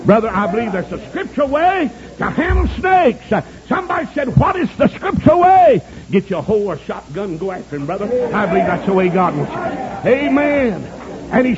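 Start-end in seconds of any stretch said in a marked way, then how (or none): 0.0-5.8 high, rising pulpit delivery; fervent preaching. Real, not acted. Preacher setting up the snake-handling answer, fervent preaching. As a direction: proclaim it high to the congregation.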